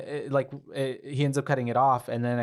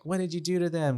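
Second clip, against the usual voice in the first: lightly acted frantic, higher-pitched demand; mock hysterical accusation; 6 semitones higher than usual. Retelling Annie turning on him over her parents, mock hysterical. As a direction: imitate someone going psycho, without shouting. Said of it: "she then goes psycho on him".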